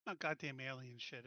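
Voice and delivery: ordinary delivery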